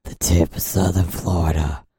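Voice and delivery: deep, put-on macho delivery; man voice. Man voice resumes.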